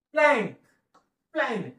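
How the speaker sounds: Plank said fast in one smooth go; quick reading. Shown as a demonstration. Teacher showing students the right quick reading. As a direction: read plank quickly, no lean on the end. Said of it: "fast".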